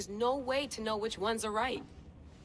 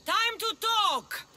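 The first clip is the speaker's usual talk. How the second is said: loud, high, drawn-out shout; long, ringing call across a distance; 12 semitones higher, 6 dB louder, much slower than usual. Acted. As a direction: holler it slowly, pitched way up. Calls out to someone at a distance.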